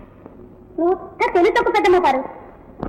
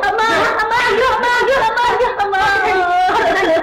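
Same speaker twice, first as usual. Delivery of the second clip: wailing in pain. Acted. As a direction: cry out as if being beaten. Child being punished cries out. (నొప్పితో ఏడుస్తూ అరవడం)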